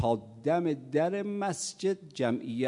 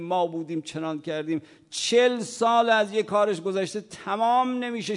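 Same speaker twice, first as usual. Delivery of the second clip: loud, higher fervent sermon; preaching; 6 semitones higher, 6 dB louder than usual. Acted. Preacher scolding boastful believers, raised fervent voice. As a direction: press the point with fervor.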